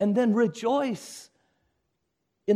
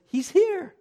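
higher, eager herald's cry of discovery; preacher voicing a herald who sees God arriving, eager and higher; excited herald; 7 semitones higher than usual. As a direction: point him out, thrilled he's come. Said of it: "Now this third voice says".

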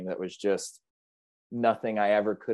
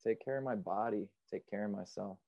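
soft sincere; 12 dB quieter than usual. Real, not acted. Sincere lesson, softly said.

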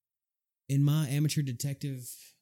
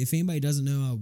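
usual speaking voice

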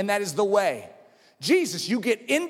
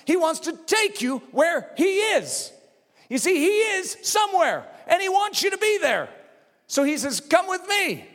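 higher, driving sermon delivery; full-force preaching; 7 semitones higher than usual. Real, not acted. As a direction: preach it with rising fervor.

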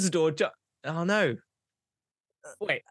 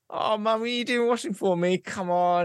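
high-pitched pleading to mum; wheedling son; 8 semitones higher than usual. Acted. Cheeky monkey asking his mum for a favour, high-pitched wheedling. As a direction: coax her in a raised voice.

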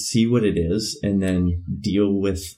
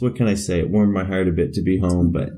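ordinary talk, nothing marked

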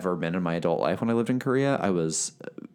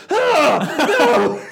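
loud laughing shriek, high; horrified shout; 12 dB louder than usual. Retold or imitated. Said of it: "I would always be like".

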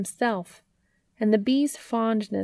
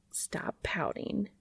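slow, soft teasing whisper; playful hushed whisper; 10 dB quieter, slower than usual. A narrator's reading. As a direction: gently tease a sulking friend. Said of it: "he buzzed teasingly".

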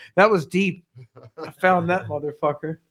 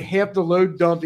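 ordinary talk

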